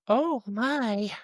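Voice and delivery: very high put-on voice; mock high-pitched reaction; imitated startled lady. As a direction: mimic her in a high, drawn-out voice. Voices the lady's startled reply.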